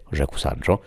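ordinary delivery, nothing marked

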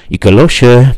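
loud, high distant call; calling from afar. A narrator's reading. Unseen someone calls Ikelos through the storm, as if distant. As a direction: call the name as if from far off. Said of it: "powiedział ktoś jakby z oddali".

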